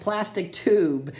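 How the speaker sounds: usual speaking voice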